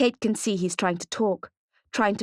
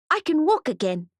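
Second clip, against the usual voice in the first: put-on higher child's voice, proud; excited little boy; 9 semitones higher than usual. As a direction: say it high like a proud small boy. Boy shows mother he walks.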